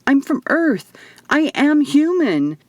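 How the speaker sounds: cling to the words as panic takes over; rising panic. Acted. Eva insisting to Will she is human, panicked. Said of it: "My panic began to rise in earnest".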